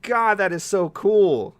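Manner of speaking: loud, high, drawn-out gushing; overjoyed, slowing into a loud happy cry; ecstatic. Real, not acted. Host overwhelmed by surprise game news, ecstatic. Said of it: "I am ecstatic".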